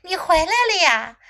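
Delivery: high, loud exclamation; lifted storybook welcome; excited cry. A narrator's reading. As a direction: cry out at a friend's return. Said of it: "克洛伊叫了起来".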